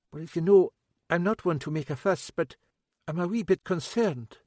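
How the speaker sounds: fussing, a wee bit worried cook; older woman, worried. A narrator's reading.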